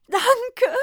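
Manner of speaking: high, loud tearful thanks; grateful sob of a thank you; sobbing with joy. A narrator's reading. Santa thanking the druggist, high tearful joy. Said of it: "schluchzte er begeistert".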